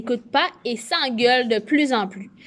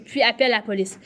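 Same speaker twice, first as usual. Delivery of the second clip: usual speaking voice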